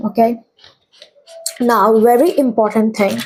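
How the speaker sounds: normal talking voice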